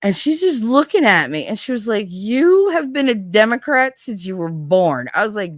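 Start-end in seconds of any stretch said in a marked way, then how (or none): 2.1-5.1 slow, louder mock indignation; imitating an indignant telling-off; retold indignant correction. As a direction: draw it out emphatically, louder and higher.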